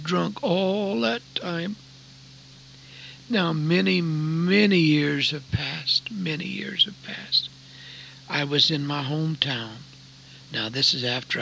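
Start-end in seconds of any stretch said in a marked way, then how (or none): 4.0-6.1 very slow, weighty storytelling; slow, drawn-out emphasis. Real, not acted. Same phrase stretched, then echoed softly. 6.1-7.5 softer, near-whispered repeat; hushed echo. Real, not acted.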